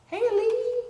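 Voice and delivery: called greeting. Real, not acted. Host greeting a viewer, called out. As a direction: call out Lee happily.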